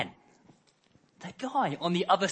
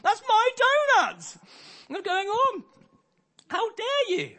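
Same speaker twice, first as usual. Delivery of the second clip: outraged cry; 6 dB louder than usual. Retold or imitated. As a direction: shriek in disbelief at the thief. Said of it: "He's like".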